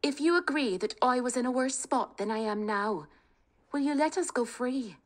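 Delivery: rally the family, high and eager; spirited young woman. Acted. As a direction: bold daughter talking her family round. Kate voiced.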